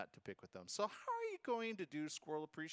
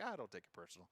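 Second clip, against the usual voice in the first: dismissive; 6 semitones lower, 5 dB quieter, faster than usual. Retold or imitated. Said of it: "You're like".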